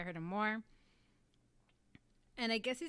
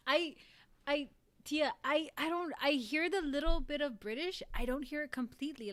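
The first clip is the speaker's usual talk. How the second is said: loud, high-pitched disbelief; surprised protest; 6 semitones higher, 6 dB louder than usual. Real, not acted. Vlogger objects to Tia that he barely sounds British, surprised protest. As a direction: protest it, stumbling over the start.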